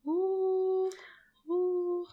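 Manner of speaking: high, drawn-out "ooh"; delighted. Real, not acted. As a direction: swoon over a treat. Swooning over Lebkuchen.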